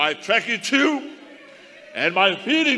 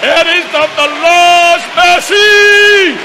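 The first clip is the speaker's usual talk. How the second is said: full-force preaching shout, much higher and louder; shouted praise; 12 semitones higher, 11 dB louder than usual. Real, not acted. Preacher praises God's mercy to the saints, shouting. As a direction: cry it out to the church, chanting.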